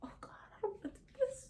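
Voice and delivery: mock weepy whisper. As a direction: fake a teary whisper. Imitating the crying Candace.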